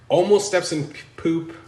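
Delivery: high, loud silly alarm; silly clown. Pretend. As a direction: yelp it like a cartoon clown. Nearly steps in poop.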